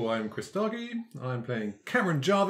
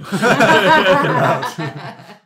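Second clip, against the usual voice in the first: big loud laugh at the joke; loud burst of laughter; 7 semitones higher, 13 dB louder than usual. Real, not acted. Cracking up at the meerkat movie joke, loud laughter.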